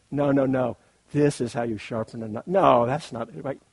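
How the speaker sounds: loud, hammed-up know-it-all correction; playful loud no-no-no correcting; mock insistent. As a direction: bluster that only your way is right. Mock rival sharpener insisting loudly.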